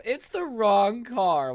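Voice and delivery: high, loud, drawn-out whine; mock wail. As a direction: parody a spoiled girl's shriek. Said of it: "we all used to go".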